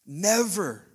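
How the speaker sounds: stronger emphasis. Real, not acted. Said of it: "God would never speak anything".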